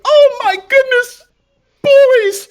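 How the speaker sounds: put-on very high, loud, slow old-mom cry of joy; delighted Ma. Ma greeting the returning boys, very high, loud and gushing. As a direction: gush over the boys. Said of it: "she'll kinda look".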